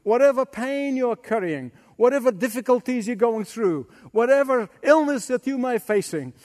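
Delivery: ordinary talk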